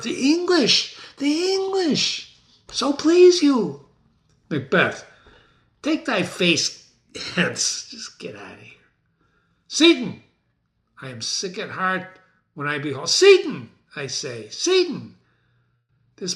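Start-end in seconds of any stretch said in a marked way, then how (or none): 0.0-3.8 high, slow quavering servant voice; frightened servant. Acted. Trembling servant tells the king the English are coming. 9.7-10.2 calling out. Acted. Macbeth calling his servant. 13.1-13.7 high shout for the servant; loud call. Acted. Macbeth shouts for his servant Seaton. 14.5-15.2 calling out. Acted. Macbeth calling his servant.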